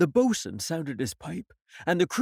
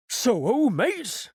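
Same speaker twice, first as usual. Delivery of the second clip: Long John hailing; 7 semitones higher, 4 dB louder, much slower than usual. Acted. Long John hails crew.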